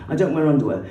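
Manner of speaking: ordinary delivery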